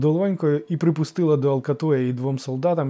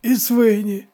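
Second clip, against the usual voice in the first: high, loud eager addition; anxious-to-please outburst; hasty interjection; 9 semitones higher, 7 dB louder, slower than usual. A narrator's reading. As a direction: jump in eagerly. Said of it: "квапливо вставив староста".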